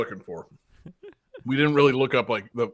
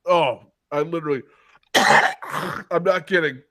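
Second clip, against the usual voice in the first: coughing through the words; just swallowed a bug; 3 semitones higher, 6 dB louder than usual. Real, not acted. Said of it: "A bug flew in my mouth".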